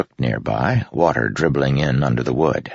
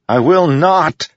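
much higher, louder defiant shout; 14 semitones higher, 6 dB louder than usual. A narrator's reading. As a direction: hurl it back at the storm. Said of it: "Kaladin shouted".

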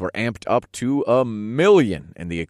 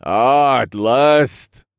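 big, loud, raised regal welcome; friendly king; 5 semitones higher, 10 dB louder, much slower than usual. Acted. King welcoming an awaited visitor, friendly loud voice. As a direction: draw it out in hearty royal greeting. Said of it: "the king said in a friendly tone".

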